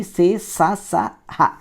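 సే, స, స, హ let out with a push of air; blown-out sounds. Shown as a demonstration. Teacher recapping blown letters for students, సే, స, స, హ on the breath. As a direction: puff air through సే, స, స, హ. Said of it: "ఊది పలికే అక్షరాలు".

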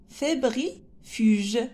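"fébrifuges" slowly in chunks, fébri, pause, fuge; sounded out slowly. Shown as a demonstration. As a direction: break up "fébrifuges" for young readers. Teacher sounds out a hard word. (« fébrifuges » lu lentement, en morceaux)

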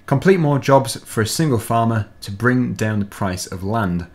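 ordinary talk

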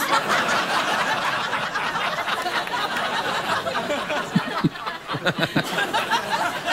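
airy laugh up high, almost silent; high breathless laugh. Real, not acted. Cracking up at his cohost's Morgan Freeman line, breathless laugh. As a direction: wheeze with laughter, no voice.

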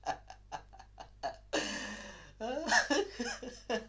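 long unhinged laugh; mad laughter. Acted. Madman laughing at his silent listener, mad laughter. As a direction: break out in high, crazed laughter.

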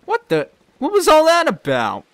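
loud, high indignant outcry; Drippy outburst. Acted. Drippy yells after the fleeing thief, indignant. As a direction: blurt out the outrage.

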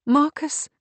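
high, rising, unfinished question; trailing doubt. A narrator's reading. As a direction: question the name and let it die. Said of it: "She let the sentence die".

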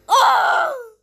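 high, loud "oh!"; startled scream. Acted. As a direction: cry out in sudden alarm. Car explodes in play.

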